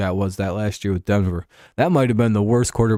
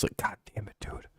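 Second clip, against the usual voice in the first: much softer whispered gripe; hushed muttered curse at a rival team; whispered grumble; 16 dB quieter than usual. Real, not acted. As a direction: whisper it under your breath in frustration. Grumbling aside about Colts.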